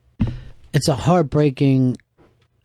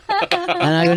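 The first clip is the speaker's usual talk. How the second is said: usual speaking voice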